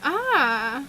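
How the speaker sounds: ordinary talk, nothing marked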